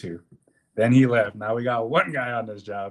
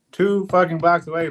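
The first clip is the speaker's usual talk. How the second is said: louder and higher, fed up; exasperated outburst; 6 semitones higher, 7 dB louder than usual. Real, not acted. Complaining to a friend about the parking, exasperated outburst. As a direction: blurt it out, exasperated at the distance.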